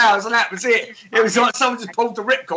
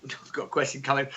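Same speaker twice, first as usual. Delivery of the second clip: deeper, softer radio host voice; 7 semitones lower, 8 dB quieter than usual. Shown as a demonstration. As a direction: announce it like a radio DJ. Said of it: "I can really sound like a fucking radio host".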